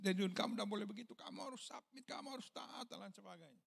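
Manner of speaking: calm him down tenderly; wife gently urging her husband to submit; gentle wife's counsel. Retold or imitated. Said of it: "istri saya langsung bilang".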